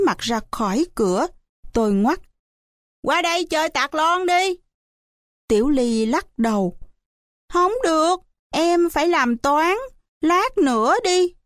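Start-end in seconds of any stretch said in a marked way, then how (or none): 3.0-4.6 high-pitched inviting call; calling over to play. A narrator's reading. 7.5-11.3 put-on high-pitched child's refusal; little child declining. A narrator's reading. Child refusing, must do homework.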